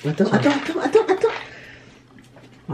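high, stretched-out desperate stalling; panicked stalling. Real, not acted. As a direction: drag it out in panic. Gagging on a gross bean.

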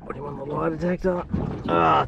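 ordinary delivery

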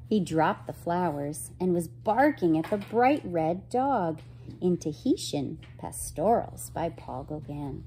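ordinary delivery

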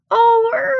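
high startled groan; groan, caught out by the crash. A narrator's reading. Percy groaning after smashing the luggage trolley. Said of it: "groaned Percy".

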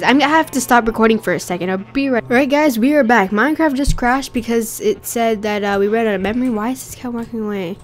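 ordinary talk

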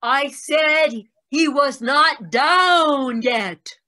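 loud slow shout at a deaf woman. Acted. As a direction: shout it slowly so she hears.

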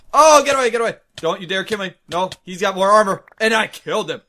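high, loud panicked fight yelling; excited yell. Real, not acted. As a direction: shout through the fight. Fighting an enemy up close.

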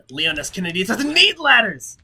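loud, high heroic yell of the name; hyped shout. Acted. Gamer cheering his hero past the ladder, yelling. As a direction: shout it like an action hero boast. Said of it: "emphasize the S in his name".

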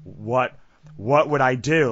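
freaking out. Retold or imitated. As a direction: relive the alarm, voice jumping up. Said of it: "I remember freaking out".

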